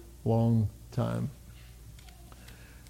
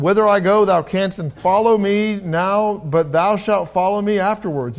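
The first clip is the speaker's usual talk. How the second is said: raised, slow, ringing King James reading; scripture reading; 5 semitones higher, 4 dB louder than usual. Acted. Pastor reads John to the congregation, raised and solemn. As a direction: proclaim the verses to the church, drawn out.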